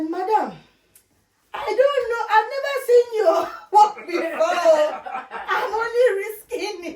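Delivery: high, loud, laughing imitation of the doubter; mock doubtful client. Imitating the doubtful company man, laughing. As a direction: mimic his skeptical warning, giggling. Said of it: "the man said".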